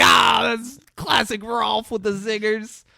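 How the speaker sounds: high, loud mock crowd roar; crowd laughing along. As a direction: shout it like a whole party cracking up at a joke. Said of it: "And everyone laughs. Everyone's like".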